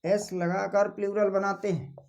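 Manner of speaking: word-by-word dictation with long pauses; slow dictation. Acted. Teacher giving students the exception rule, slow dictation. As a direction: dictate slowly, leaving time to write.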